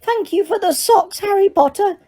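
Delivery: high elf voice, called out sadly; miserable Dobby. A narrator's reading. Dobby calls out thanks to Harry, high and sad. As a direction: call it out forlornly. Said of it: "Dobby called miserably".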